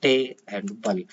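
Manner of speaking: टे and बल pulled apart, slow, with a break between; syllable split. Shown as a demonstration. Teacher breaking table into two syllables for students, slowly. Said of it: "इसमें दो syllable है".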